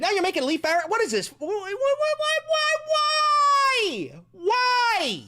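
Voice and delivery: high, rising cries of disbelief; exasperated wail. Real, not acted. Exasperated wailing at the clip.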